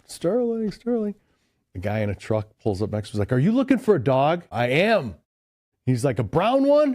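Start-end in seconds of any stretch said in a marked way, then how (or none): 0.1-1.1 high, sing-out dog call at contained volume; imitated calling yell. Driver calling his lost dog from the car window, mock yell. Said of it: "and I'm yelling". 3.3-5.2 raised, louder voice across a distance; imitated calling out. Truck driver and dog owner talking between vehicles, imitated calling out. 6.2-6.9 high, loud question called across; imitated calling out. Truck driver asking owner about the lost dog, imitated holler.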